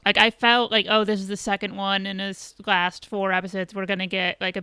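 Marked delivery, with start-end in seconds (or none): none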